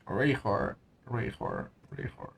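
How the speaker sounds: fading to almost nothing, puzzled; trailing off. Real, not acted. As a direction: mutter it away to silence. Trying out unfamiliar name.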